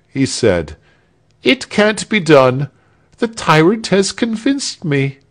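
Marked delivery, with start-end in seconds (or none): 1.4-5.2 high-pitched complaint; aggrieved character voice. A narrator's reading. Returns defeated with burnt finger.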